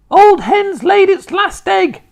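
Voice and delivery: slow, piercing high screech; very loud, drawn-out high holler; Grandma shouting. A narrator's reading. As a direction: shout it out like a scornful old woman. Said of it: "Grandma shouted".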